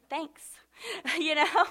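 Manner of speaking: laughing through a wry reply. Real, not acted. Preacher joking about an unwelcome prophecy, laughing. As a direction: break into laughter on the words.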